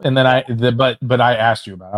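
ordinary talk

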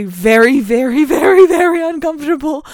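loud, rising run on "very", high "uncomfortable"; escalating emphasis. Real, not acted. Critic squirming at the champagne scene, mounting emphasis on "very".

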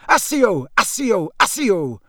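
high cries stepping down in pitch; shouted. A narrator's reading. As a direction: fire off each spell as a mild shout. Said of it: "she shouted".

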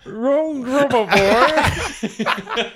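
mock royal voice as if throwing up; Prince Charles impression. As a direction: laugh through a drawn-out, gagging Charles voice. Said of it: "impersonate Chuck ... throwing up in an English accent".

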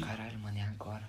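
muttered panic. Retold or imitated. Retold panic thought.

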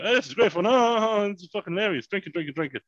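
high-pitched retold party excitement; mock drunken revelry. Drinker reliving pool fun with strangers, mock excited. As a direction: play up the poolside merriment, pitched high.

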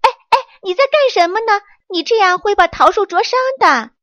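high, loud, fast angry rebuke; loud scolding. Acted. Monkey angrily scolding the woodpecker for pecking the tree. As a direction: scold him sharply to stop. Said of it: "他大声地斥责道".

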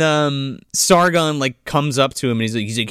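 normal talking voice

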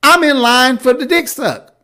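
high, loud, laughing rant; excited rant. Real, not acted. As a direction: get worked up and laugh through it. Hyped, laughing rant about being twenty-one.

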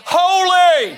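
loud, high, drawn-out cry; big ringing shout, second syllable stretched. Acted. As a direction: call it out like a mighty angel. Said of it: "One cried to another".

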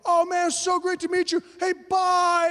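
high, loud, enthusiastic goodbye; shouted farewell. Retold or imitated. New friends calling as he walks away, shouted farewell. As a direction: call after someone walking off.

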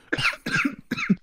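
sudden high choking cough. Real, not acted. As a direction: cough hard on a swallowed drink. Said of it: "Hab mich verschluckt".

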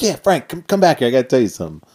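high, loud call to come back. Retold or imitated. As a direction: wave him back with your voice. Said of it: "He's like".

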